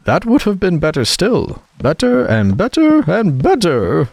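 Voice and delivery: climbing pitch ending in a squeak; rising to a squeak. A narrator's reading. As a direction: let the voice rise on every better into a squeak. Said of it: "Her voice went higher with each better, till it got quite to a squeak".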